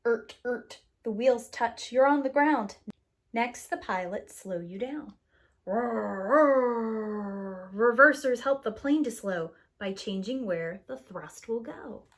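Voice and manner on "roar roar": swelling mock engine roar, louder; reverser roar. Reversers slow the plane, swelling mock roar. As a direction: roar it like jets braking.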